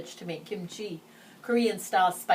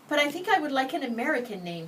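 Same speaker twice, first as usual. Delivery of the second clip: quickly; 8 semitones higher, 3 dB louder, faster than usual. Acted. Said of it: "she said quickly".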